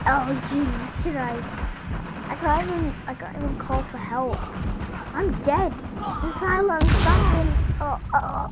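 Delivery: a gamer yelling about dying; game frustration. Real, not acted. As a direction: exclaim in real dismay. Killed in game, exclaiming.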